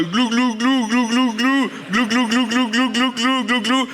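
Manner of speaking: high gurgling glug-glug noises; gobbling turkey voice. Pretend. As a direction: gurgle like someone going under water. Mocks drowning artists, gurgling.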